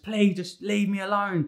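pleading scream held inside, slowly; mock inner scream. Worn-out dad screaming in his head at the babies, mock inner scream. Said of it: "I'm just screaming".

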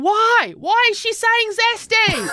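put-on high, whiny kid voice; imitating son. Mother imitating her son whining from upstairs, high and whiny. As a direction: whine it like a baffled kid. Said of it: "And he's going".

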